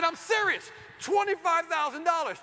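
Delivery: loud, high emphatic demand; exasperated insistence. Retold or imitated. Husband repeating the ignored budget to the landscaper, loud exasperation. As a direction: hammer the figure home.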